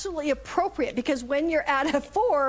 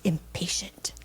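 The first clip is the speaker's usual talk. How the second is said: put-on soft, lower whisper of a kid; whispered child repeat; 5 semitones lower, 4 dB quieter, slower than usual. Granddaughter repeating the new word, whispered. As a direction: barely breathe the word back.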